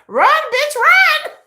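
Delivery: high mocking chant of run; gleeful cheering. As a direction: cheer the chase with glee. Cheering the chase.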